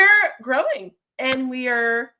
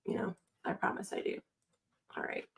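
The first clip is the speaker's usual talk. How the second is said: soft whisper to herself; unvoiced self-reassurance; whispered aside; 14 dB quieter, faster than usual. Real, not acted. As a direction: whisper it as a private promise. Whispered aside while fixing stream.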